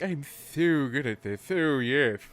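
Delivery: loud, smug and pleased with itself; snooty smug gloating. Retold or imitated. Smug nerd self-praise, louder.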